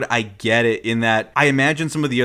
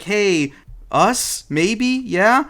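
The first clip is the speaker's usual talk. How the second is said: higher, questioning come-on; flirty suggestion; 7 semitones higher, much slower than usual. Acted. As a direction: float each bit up hopefully, with pauses. Voices Minako flirting with Kaori.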